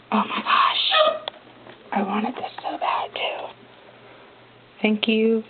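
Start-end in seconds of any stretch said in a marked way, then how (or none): 0.1-1.2 high, slow breathless gasp of delight; breathless awe. Real, not acted. To her viewers, seeing the album insert, breathless high awe. Thrilled unboxing reveal. 1.9-3.5 excited whisper. Real, not acted. Thrilled unboxing reveal.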